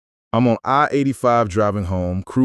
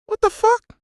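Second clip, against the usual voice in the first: squeaky, much higher disbelieving yelp; shocked outburst. Acted. Narrator told he works for them now, high shocked yelp.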